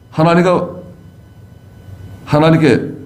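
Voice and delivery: normal talking voice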